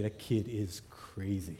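ordinary delivery, nothing marked

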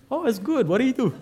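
high-pitched, imitating a casually curious golfer; chirpy golfer's reply. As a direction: act out his question way up high. Golfer's cheerful reply, acted out.

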